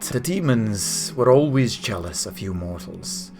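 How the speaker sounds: grave, measured angelic sermon; solemn angel. Acted. Angel telling mortals of the One's gift, solemn. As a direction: ancient celestial explaining slowly, with pauses. Game master voices angel Uriel.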